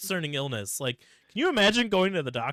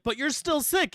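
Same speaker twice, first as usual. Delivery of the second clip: worried protest; 12 semitones higher, 4 dB louder than usual. Retold or imitated. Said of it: "everybody's like".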